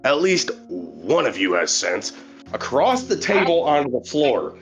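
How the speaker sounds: usual speaking voice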